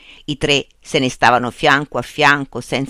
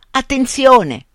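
higher and louder protest; repeated warning; 8 semitones higher, 6 dB louder, slower than usual. A narrator's reading. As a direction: warn them off, louder. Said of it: "ripeté". (avvertimento ripetuto, più forte)

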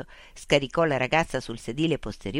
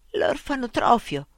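a higher, sudden exclamation; girl suddenly crying out the name, higher; 8 semitones higher than usual. Acted. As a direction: cry out on recognizing the place. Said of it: "gridò improvvisamente".

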